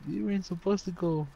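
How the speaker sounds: high, fast, shrill-pitched mock scold; hammed-up high scolding voice; mock scolding family. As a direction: squeal it out like a panicked relative telling the kid off. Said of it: "They're like".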